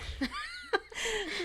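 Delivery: a sudden high, bright burst of laughter. Real, not acted. Guest laughing at the crying baby next door, high laugh. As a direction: squeal with laughter at the image.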